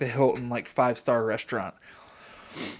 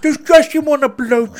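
much higher, loud put-on child voice; gross child voice; 12 semitones higher, 9 dB louder than usual. Showing his friend the gross child voice he always does, much higher and loud. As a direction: squeak it out like a creepy little kid. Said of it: "child voice thingy".

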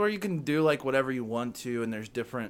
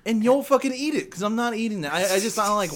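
louder, higher mock outrage; mock angry; 8 semitones higher, 6 dB louder than usual. Real, not acted. Heated banter over flavors.

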